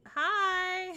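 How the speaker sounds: high, loud drawn-out hi; loud greeting. Real, not acted. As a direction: call out a sudden bright hello. Breaks off to greet someone.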